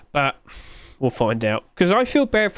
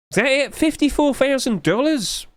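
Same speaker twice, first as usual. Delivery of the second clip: surprised; 11 semitones higher than usual. Real, not acted. Surprised at low sales figure.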